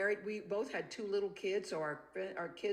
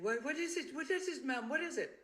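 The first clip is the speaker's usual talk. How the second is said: anxious nanny; 7 semitones higher than usual. Retold or imitated. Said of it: "And she said".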